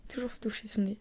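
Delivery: unvoiced whisper to herself. Real, not acted. Whispering to herself at 23:23, unvoiced whisper. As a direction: breathe it like a secret ritual. Mini ritual at 23:23.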